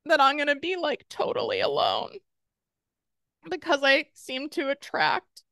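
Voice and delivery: high, vulnerable voice of fear; voicing her fear. Shown as a demonstration. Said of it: "So I'm gonna do it with you".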